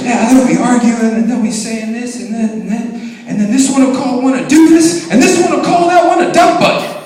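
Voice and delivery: loud, high, building mock tirade; animated dad impression. Dad telling congregation about his kids' fights, animated and loud.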